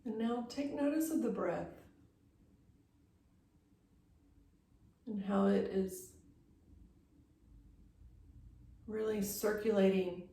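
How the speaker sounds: unhurried yoga-teacher meditation voice, long pauses between phrases; guided meditation. Real, not acted. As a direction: guide them gently into stillness, leaving space after each phrase. Leads guided breathing meditation.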